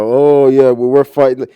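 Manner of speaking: fake rebel. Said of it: "going around like".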